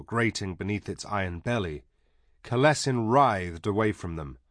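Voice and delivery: ordinary delivery, nothing marked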